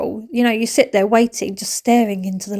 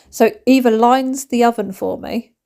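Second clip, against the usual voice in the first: ordinary talk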